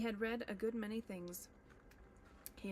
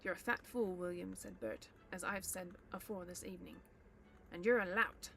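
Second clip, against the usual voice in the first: angry troll quarrel. Acted. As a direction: spit the insults at William. Bert quarrels.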